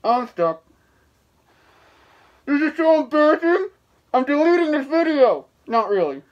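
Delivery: muffled behind mask; through a latex mask. Real, not acted. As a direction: mouth covered by rubber. Seen 4: Full latex mask covers whole head and mouth while speaking.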